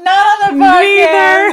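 laughing refusal. Retold or imitated. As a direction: refuse while cracking up. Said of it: "I was like".

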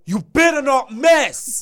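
high, loud mock shouted threat. Host shouts a joking warning at cohost, loud and high. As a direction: yell it like a playful warning.

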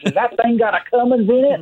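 mock Southern awe; Southern accent. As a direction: pitch it to the ad guys as a wowed Southern cowboy. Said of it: "doing the Southern accents".

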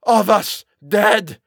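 loud, high, drawn-out terror; shrieking. A narrator's reading. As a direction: shriek slowly in utter horror. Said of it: "he shrieked".

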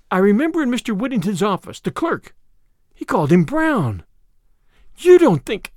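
high voice, piecing it together; excited heroine. Acted. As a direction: build to a loud, high, broken-off question. Narrator voices Tuppence recalling a clue.

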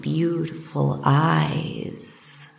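plain reading voice

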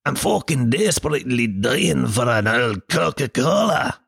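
put-on Scottish accent, hammed-up craving. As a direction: play a desperate Scot dying for a drink. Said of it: "I don't know why you're Scottish".

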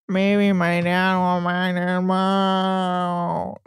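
very slow, smeared drone; hammed-up mockery of a droning son; exaggerated monotone drawl. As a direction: stretch it absurdly on one dead note. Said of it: "in his weird monotone drawl".

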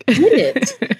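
amazed at herself. Retold or imitated. Retelling her own amazed reaction.

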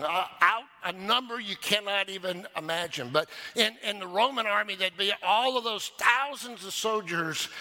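high, driving pulpit delivery; impassioned preaching. Real, not acted. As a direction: preach it with full energy. Preaches with rising force.

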